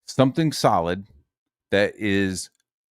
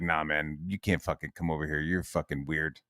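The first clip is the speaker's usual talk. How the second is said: low mocking voice; 7 semitones lower, 9 dB quieter than usual. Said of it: "they were like".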